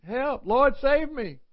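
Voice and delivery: high, loud cries for rescue; frantic pleading shout; Peter crying out. Acted. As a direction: call out like a drowning man. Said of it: "that's what, uh, Peter said".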